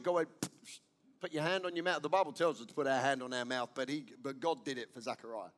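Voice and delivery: normal talking voice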